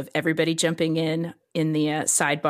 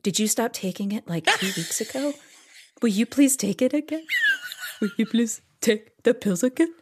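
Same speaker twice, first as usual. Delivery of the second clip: wary pleading; 4 semitones higher than usual. Retold or imitated. Jason begging her to take the pills again, warily. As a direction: tiptoe through a careful plea. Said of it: "eyes just get really wide".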